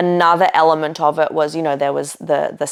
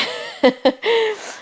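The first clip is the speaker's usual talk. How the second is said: laugh. Real, not acted. Laughing at her own fear.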